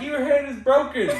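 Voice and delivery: high, laughing mock disbelief; shocked disbelief. Teammate staring at the broken hand, high laughing disbelief.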